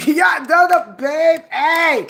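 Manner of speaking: excited shout. Real, not acted. Bragging to her viewers, gleeful shout. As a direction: call it out with pride.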